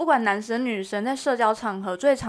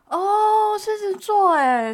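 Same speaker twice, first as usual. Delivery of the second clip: excited; 10 semitones higher, 5 dB louder, much slower than usual. Retold or imitated. Acted quote of strangers.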